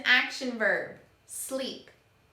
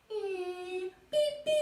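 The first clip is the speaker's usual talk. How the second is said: beep beep horn; 8 semitones higher than usual. Pretend. Miming honking a car horn.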